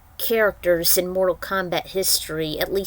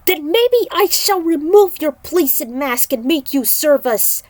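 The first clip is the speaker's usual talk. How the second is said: higher and louder, menacing; a raised, menacing warning to the demon; angry threat; 8 semitones higher, 6 dB louder than usual. Acted. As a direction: push the threat hard. Kung Lao threatens to remove mask.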